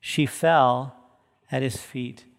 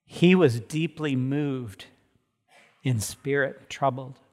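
slow, heavy reading; 2 semitones lower, slower than usual. Real, not acted. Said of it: "slow reread".

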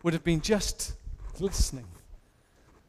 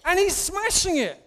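much higher, louder, hammed-up panic; mock dismay; 7 semitones higher, 4 dB louder than usual. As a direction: squeal it in playful mock alarm. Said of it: "I'm like".

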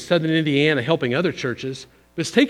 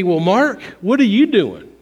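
raised, louder imitation of a questioner; mock challenge; 4 semitones higher, 4 dB louder than usual. Listener questions the preacher's own practice, mock challenge.

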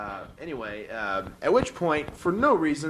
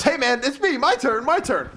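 put-on high, louder kid-like eagerness; mock eager; 13 semitones higher, 6 dB louder than usual. Deputy Gordy begging for his turn to hit, eager. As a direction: bounce like a kid waiting in line.

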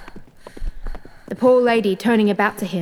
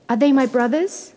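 higher, rising question; eager, worried question; anxious hope; 5 semitones higher, 4 dB louder than usual. A narrator's reading. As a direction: ask with urgent hope, pitch lifting. Wife asks anxiously.

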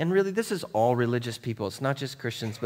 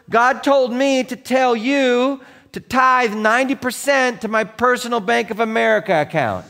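mocking, louder put-on preacher quoting a greedy demand; mock manipulator; 12 semitones higher, 10 dB louder than usual. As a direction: send up the con man's God talk. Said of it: "As opposed to".

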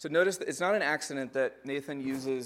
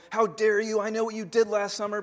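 mock accusing shout, higher and louder; guns a-blazing; 8 semitones higher, 8 dB louder than usual. Preacher mocking a hothead who confronts people, loud and high. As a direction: play the hothead confronter, hammed up. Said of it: "come in with guns a-blazing".

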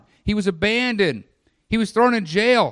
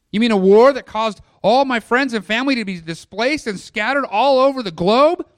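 higher, louder challenging question; protesting in mounting disbelief; incredulous objector; 4 semitones higher, 6 dB louder than usual. Acted. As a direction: push the disbelief up to a loud question. Voices an incredulous objector to the verse.

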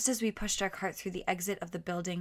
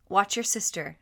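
louder, stern instruction; firm order; 2 semitones higher, 7 dB louder than usual. A narrator's reading. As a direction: tell him firmly. Mother orders son.

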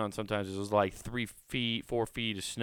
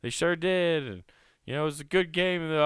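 higher, lightly strained good-sport voice; mock gracious loser; 9 semitones higher, 5 dB louder than usual. Answering gloating family as a forced good sport, higher voice. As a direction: concede through your teeth, playing nice. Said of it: "I'm just gonna be like".

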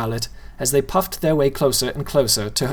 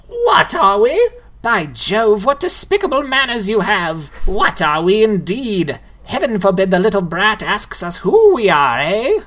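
much higher, mock-offended ghoul; scandalised ghoul; 8 semitones higher, 4 dB louder than usual. A narrator's reading. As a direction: act appalled at the brat's manners. Ghoul scolds the girl.

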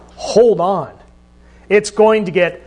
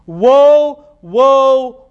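louder, higher, ringing proclamation; loud angel cry; 4 semitones higher, 4 dB louder than usual. Acted. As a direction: call it out like a herald from the sky. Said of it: "saying with a loud voice".

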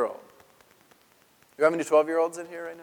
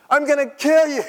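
high, loud exasperated mom; frantic mother; 11 semitones higher, 9 dB louder than usual. Acted. Mary scolding her runaway son, shrill and loud. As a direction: shriek it like a panicked mother. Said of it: "So Mary says to him".

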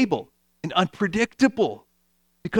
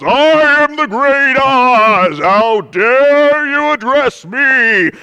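much higher, louder, slow booming wizard voice; booming great Oz; 7 semitones higher, 8 dB louder, much slower than usual. Retold or imitated. The Wizard scaring the travellers, slow booming and loud. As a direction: thunder it out like a fake almighty wizard. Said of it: "they talk to the great Oz".

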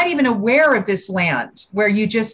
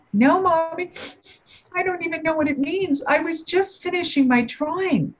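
put-on much higher, softer small child's voice; little girl; 10 semitones higher, 3 dB quieter than usual. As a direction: plead innocently as a six-year-old. Mother voices her small daughter.

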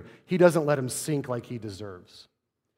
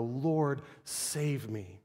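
subdued plea; 2 semitones lower, 9 dB quieter, much slower than usual. Acted. Unwilling to cry out to Christ, subdued plea. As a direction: let the plea sink down quietly. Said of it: "cry out".

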